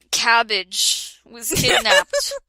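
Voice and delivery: ordinary talk, nothing marked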